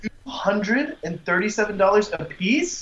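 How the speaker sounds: high, slow incredulous repeat; shocked disbelief. Real, not acted. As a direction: drag it out in disbelief.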